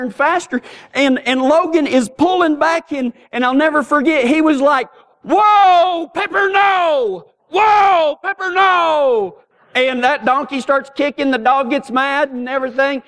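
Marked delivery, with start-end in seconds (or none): 5.2-9.4 hollering at the dog. Retold or imitated. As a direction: yell the dog off the runaway donkey. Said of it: "he's hollering at this donkey".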